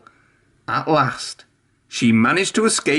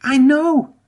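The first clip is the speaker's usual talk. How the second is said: very high and louder, happy; bubbly Emily; 14 semitones higher, 4 dB louder than usual. A narrator's reading. Emily agrees with Spencer, very high and happy. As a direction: bubble over with joy, high. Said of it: "bubbled Emily happily".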